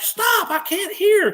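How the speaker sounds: high, urgent imitated holler; retold shout. Engineer turning round under gunfire, imitated urgent shout.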